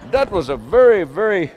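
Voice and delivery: ordinary talk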